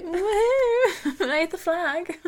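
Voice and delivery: high, loud woohoo with a laugh; mock cheer. Friend mocking Hannah's pride with a high whoop. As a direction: cheer like a proud ally.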